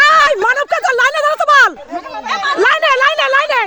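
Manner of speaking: calling out orders. Real, not acted. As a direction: marshal the players over the noise. Host ordering players into line.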